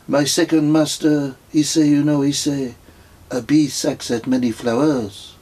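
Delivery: French pilgrim quoting wisdom sagely; pilgrim quoting his master, French accent. A narrator's reading. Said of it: "in a strong French accent".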